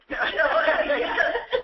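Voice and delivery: high burst of laughter; amused laugh. Real, not acted. Host laughing at the idioms she uses, amused. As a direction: laugh at your own example.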